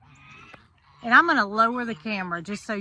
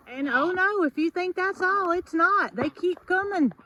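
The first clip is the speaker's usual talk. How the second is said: excited; 7 semitones higher than usual. Real, not acted. Parade of geese keeps coming.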